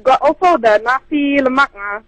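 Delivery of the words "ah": ordinary talk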